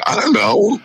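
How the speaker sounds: high, clueless "I don't know"; mock clueless. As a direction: play dumb with a high-pitched shrug. Deadpan reply to security guy.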